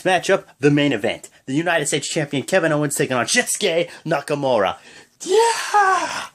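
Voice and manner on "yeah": high, loud burst of a cheer; excited yell. Real, not acted. Vlogger cheering the main event announcement, excited yell.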